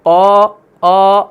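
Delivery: slow, rounded aa in قا، آ; heavy, dark long vowel in قا، آ; mouth-filling thick alif. Shown as a demonstration. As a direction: fill the mouth and hold قا، آ. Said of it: "منہ بھر کے".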